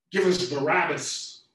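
loud, slow, high demand. Acted. The crowd demanding Pilate release Barabbas, loud and slow. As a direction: call for the murderer's release.

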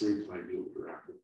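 plain reading voice

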